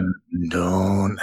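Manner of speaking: low cartoon dad, breaking off; low, half-hearted cartoon dad attempt; Homer Simpson. Retrying a voice he can't hit, weak Homer impression. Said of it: "can't hit Homer".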